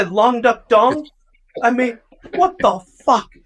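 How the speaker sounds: high, exasperated outraged ranting; incredulous high-pitched fuming; indignant rant. Retold or imitated. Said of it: "But I'm like".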